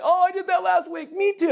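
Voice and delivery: high, loud cheerful confessions; friendly strangers. Retold or imitated. People in line laughing along with the pastor, high and cheerful. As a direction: chime in gladly as if sharing the blame. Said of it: "It's like".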